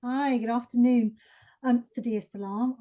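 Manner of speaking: usual speaking voice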